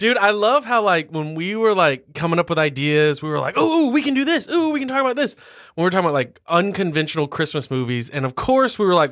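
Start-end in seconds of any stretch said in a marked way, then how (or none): 3.6-5.3 excited. Retold or imitated. Excitedly recalling brainstorming ideas.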